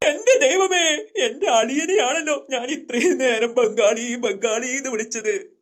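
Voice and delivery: slow, drawn-out wail of dismay; comic dismay. Acted. Boy learns he insulted his brother-in-law, comic dismay.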